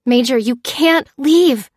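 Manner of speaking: loud, slow urgent plea; insisting. A narrator's reading. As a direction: dig in and insist. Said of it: "insisted Lucy".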